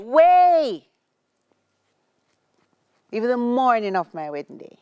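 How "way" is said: loud high insistent way; emphatic shout. Real, not acted. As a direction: belt it out, insisting. Emphatic reply in way banter.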